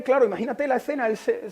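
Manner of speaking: ordinary talk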